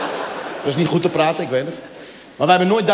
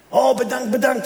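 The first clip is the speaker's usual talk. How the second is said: much higher thrilled thank-you; delighted cleaner; 8 semitones higher, slower than usual. Retold or imitated. Claudia thanking the couple for fifty euros, much higher gushing voice. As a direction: gush with delight, pitched up.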